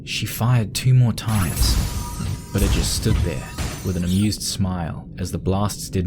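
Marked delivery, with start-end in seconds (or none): none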